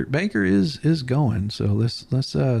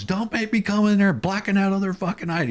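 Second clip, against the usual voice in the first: retold yell; 8 semitones higher than usual. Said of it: "yelling at the top of his lungs".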